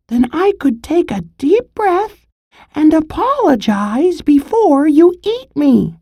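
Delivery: very high, strained pleading squirrel voice; squeezed and pleading. Acted. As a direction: squeak out the words while pinned down, short of air. Squirrel pinned, gasping, pleading.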